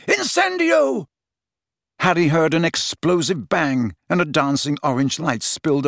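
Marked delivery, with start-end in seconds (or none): 0.1-1.1 high, stretched-out shout; yelled spell. A narrator's reading. Huge Death Eater casting fire, yelled. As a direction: bellow the incantation, drawing it out. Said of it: "the huge Death Eater behind him yelled".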